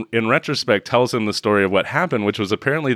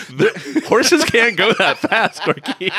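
high-pitched, slow, through laughter; incredulous, laughing protest; laughing disbelief; 11 semitones higher, slower than usual. Real, not acted. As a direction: laugh out your exasperation, drawing it out.

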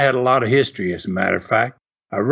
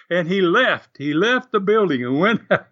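ordinary talk, nothing marked

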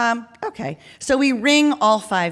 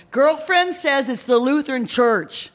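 higher call through an intercom; 6 semitones higher than usual. Acted. Man on intercom corrects canvassers, calling out. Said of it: "the guy in the speaker calls out again".

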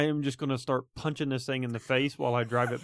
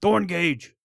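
loud, high yell for the captain; calling out; 6 semitones higher, 8 dB louder than usual. Acted. Player's character yells out for the captain, loud call. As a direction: holler the name out loudly. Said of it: "I yell out for the captain".

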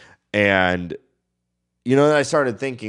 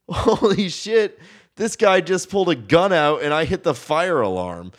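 panicked; 8 semitones higher than usual. Retold or imitated. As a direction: blurt it out in a panic. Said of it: "I totally would have been like".

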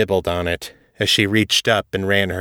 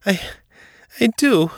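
high, fervent French maid confession; impassioned maid, pitched right up; crying out; 11 semitones higher than usual. A narrator's reading. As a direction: blurt it out in a much higher voice. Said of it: "she cried".

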